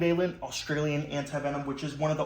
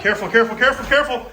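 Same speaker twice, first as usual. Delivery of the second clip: urgent warning; 6 semitones higher, 9 dB louder, much faster than usual. Real, not acted. Snake coming at them.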